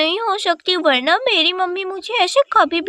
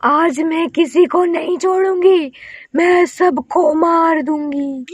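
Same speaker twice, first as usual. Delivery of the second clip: witch threat. Acted. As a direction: threaten the house like a storybook witch. Witch threatens the whole family.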